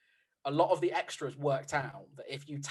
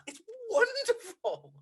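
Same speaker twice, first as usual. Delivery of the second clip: squealing gush; slower than usual. Real, not acted. Gushing over performance.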